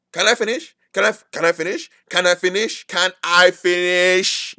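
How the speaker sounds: nagging condescending can I finish; pushy condescending. Shown as a demonstration. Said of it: "you don't need to be".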